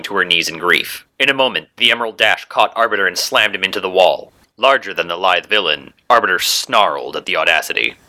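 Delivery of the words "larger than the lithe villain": ordinary delivery, nothing marked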